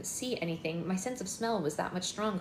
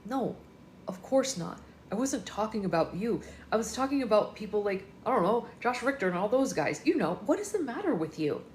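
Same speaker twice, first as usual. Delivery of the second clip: changed tone; 4 semitones higher, 4 dB louder than usual. Acted. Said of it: "in a quite different tone of voice".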